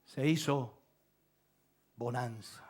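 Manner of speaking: muy bajo, grave y pausado; calma repentina. Real, not acted. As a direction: deja caer la voz como mar en calma. Calma repentina tras la tempestad predicada. (voz baja y serena, con pausas)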